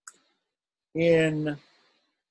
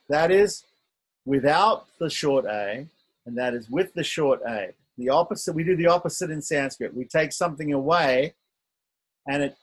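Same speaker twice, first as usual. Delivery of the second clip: ordinary talk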